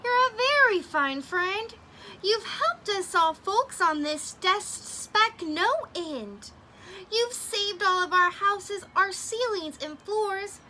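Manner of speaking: a hint of a tiny grateful mayor; tiny mayor's voice. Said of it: "came the voice".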